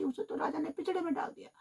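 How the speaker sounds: plain reading voice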